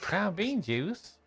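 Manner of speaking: puzzled. Acted. [name] puzzled by odd phrase.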